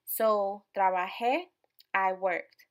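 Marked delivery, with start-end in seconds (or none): 0.8-1.4 "trabajé" with the final é stressed and rising; stress on accented ending. Shown as a demonstration. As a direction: land firmly on the last syllable of "trabajé". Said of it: "put emphasis on it".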